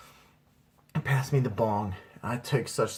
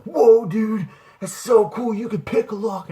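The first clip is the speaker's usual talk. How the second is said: high, loud wowed shout; amazed kids; 11 semitones higher, 8 dB louder than usual. Retold or imitated. Kids amazed he opened the door, high loud awe. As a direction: gush like impressed young teens.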